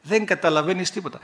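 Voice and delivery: ordinary delivery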